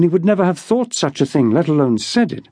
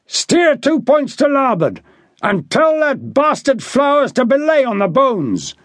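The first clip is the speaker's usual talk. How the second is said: shouted orders; 8 semitones higher than usual. A narrator's reading. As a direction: bark the order up to the helm. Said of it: "Harry shouted".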